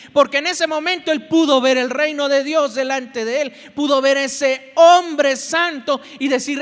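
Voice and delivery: loud, ringing sermon delivery; full-force preaching. Acted. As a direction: preach it out to the congregation.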